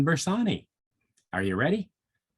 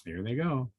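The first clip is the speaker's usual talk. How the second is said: ordinary delivery, nothing marked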